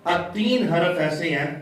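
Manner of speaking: ordinary delivery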